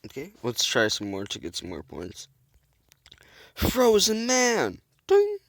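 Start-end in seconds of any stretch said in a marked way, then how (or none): 3.5-4.8 slow, loud hero-style shout of the move name; booming move announcement; dramatic call-out. Pretend. 5.1-5.4 very high, loud ding sound; ping it out like a bell; vocal bell ding; high ding effect. Pretend.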